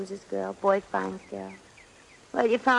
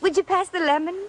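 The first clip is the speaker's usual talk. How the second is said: prim put-on English accent, hesitating; a faked genteel British request for the lemon; fake British accent, higher; 7 semitones higher than usual. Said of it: "your accent".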